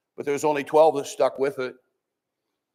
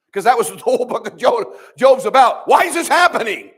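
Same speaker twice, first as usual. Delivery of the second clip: loud, rising preacher's cry, pitched higher; preaching shout; 6 semitones higher, 8 dB louder than usual. Real, not acted. Preacher crying out to the congregation about Job's suffering, shouting. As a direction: build to a full-voiced shout from the pulpit.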